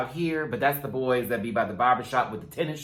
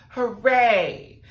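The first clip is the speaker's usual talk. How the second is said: mock cheer; 10 semitones higher, 7 dB louder than usual. Announcing the mini challenge winner.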